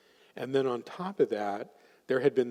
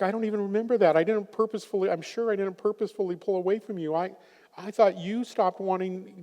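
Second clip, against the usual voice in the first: much higher, lightly voiced wife, pleading; imitating the wife; 8 semitones higher, 4 dB louder than usual. Wife protesting to husband in session, higher pleading voice. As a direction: play the wife, earnest and surprised. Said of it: "She's like".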